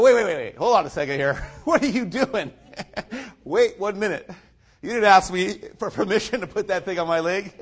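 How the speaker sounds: loud, high "wait, wait"; retold alarmed protest. Patient stopping the doctor putting on a cast, loud protest. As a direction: stop the doctor in a half-laughing panic.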